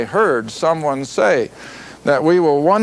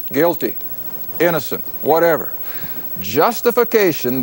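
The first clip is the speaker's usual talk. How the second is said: usual speaking voice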